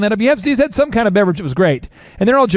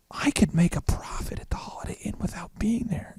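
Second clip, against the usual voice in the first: hushed whisper of a private realization; 13 dB quieter than usual. Retold or imitated. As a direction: breathe it out like a secret to yourself. Quiet realization to himself.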